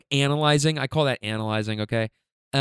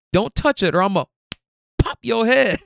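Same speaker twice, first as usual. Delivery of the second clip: retold scolding threat; 8 semitones higher, 4 dB louder than usual. Retold aunt's threat to the child.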